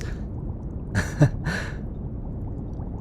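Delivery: quiet, breathy little laugh; soft chuckle. Acted. Researcher admitting they see few people, soft self-conscious chuckle. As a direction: laugh softly under your breath. Amused at own admission.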